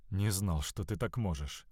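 low, soft near-whisper. A narrator's reading. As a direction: drop the voice, softly impressed. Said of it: "прошептал Уэйн".

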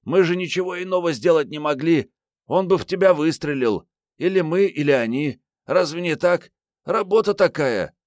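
put-on high, insistent young woman's voice; героиня книги оправдывается. A narrator's reading. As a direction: plead your case in a girlish high pitch. Героиня оправдывается перед командиром. (высоким девичьим голосом, убеждая)

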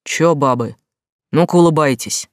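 пьяно, развязно и громко; как подвыпивший мужик, нагло; пьяный голос. Acted. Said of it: "пьяно оглядел". (пьяный окрик)

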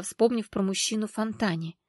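ordinary delivery

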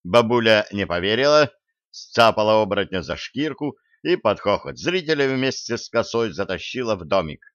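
ordinary delivery

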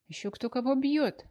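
high indignant retort; indignant cry. Acted. Wife defending lover, angry.

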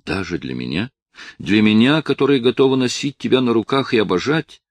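desperate pleading. A narrator's reading. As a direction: climb into a frantic plea.

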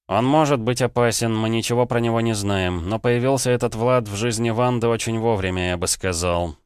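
plain reading voice

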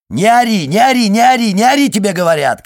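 high, very loud screamed command; furious shouting. A narrator's reading. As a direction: yell it full force from the couch. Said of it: "кричала Алиса с дивана". (яростный крик)

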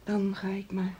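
hushed, shattered whisper; a broken whisper to herself; devastated whisper. Acted. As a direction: whisper it as the truth sinks in. Leaving in shock.

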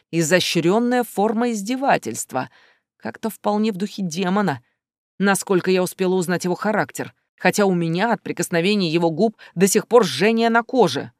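plain reading voice

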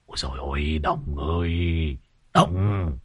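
low, slow hushed groan of dismay; muted, dragged-out plea under the breath; hushed alarm. A narrator's reading. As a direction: keep it very soft, urgently getting his attention. Alarmed companion secretly nudging Đồng.

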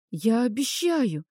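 ordinary delivery, nothing marked

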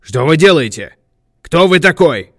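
loud, demanding yell; loud questions, climbing high; shouted challenge. A narrator's reading. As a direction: shout at a stranger to stop him. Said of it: "причём Катлер крикнул".

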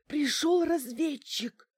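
slow, high, voiced mock whisper; urgent stage whisper. A narrator's reading. Bankina reporting an intruder by phone, voiced stage whisper. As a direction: draw it out high and secretive. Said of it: "шёпотом сообщила Банкина".

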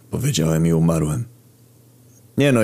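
plain reading voice

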